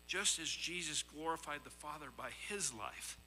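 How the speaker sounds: usual speaking voice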